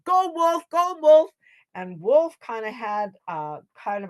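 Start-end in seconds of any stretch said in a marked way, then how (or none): 0.0-1.3 high, loud chant of support; cheering on. A narrator's reading. Animals send Wolf off, cheering. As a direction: cheer for your champion. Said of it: "the animals cheered him on".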